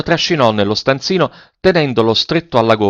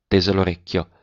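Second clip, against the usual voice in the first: plain reading voice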